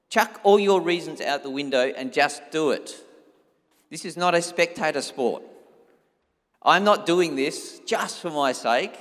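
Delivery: ordinary talk, nothing marked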